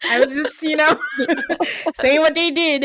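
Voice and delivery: breaking into real laughs while telling it; laughing. Real, not acted. Host cracking up at her co-host while recapping. Laughing at Asia mid-story.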